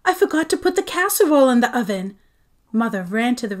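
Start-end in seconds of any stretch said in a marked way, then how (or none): 0.1-2.2 flustered rush. A narrator's reading. Mom panics, rushes.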